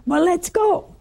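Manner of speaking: high, eager exclamation; retold eager outburst; excited. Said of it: "he was so excited".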